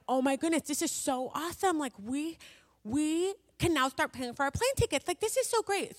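high, gushing excitement; super pumped up. Retold or imitated. Couple reacting to a surprise check, super excited. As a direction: gush as if jumping up and down. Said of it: "all excited, super pumped up".

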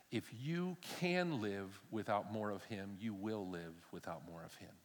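earnest and quiet. Real, not acted. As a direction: lean in and press it home gently. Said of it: "sermon climax, slow and soft".

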